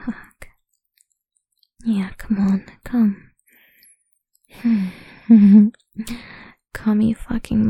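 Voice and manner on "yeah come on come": ordinary delivery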